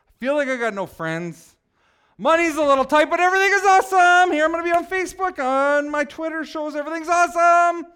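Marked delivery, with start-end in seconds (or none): 2.2-7.9 forced cheer. Pretend. Mock forced cheer in denial.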